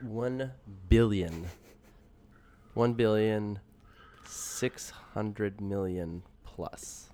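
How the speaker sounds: slow, weighty number; slow emphatic figures. Real, not acted. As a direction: drag out the big number. Reading out box office number.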